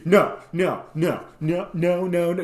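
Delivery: mock stern wife repeating no; no train from hell. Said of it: "went the no train from hell".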